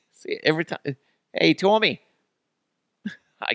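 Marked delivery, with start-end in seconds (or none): 1.3-2.0 high-pitched attempt at Boston; hammed-up Boston greeting; bad Boston accent. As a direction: call a buddy in a botched Boston accent. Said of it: "trying to do a Boston accent".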